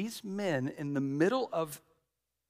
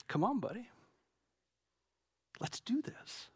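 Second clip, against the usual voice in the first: whispered nudge from a father; imitating dad's quiet whisper; dad urging; 7 semitones higher, 8 dB quieter than usual. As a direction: coax the boy under your breath. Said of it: "and he goes".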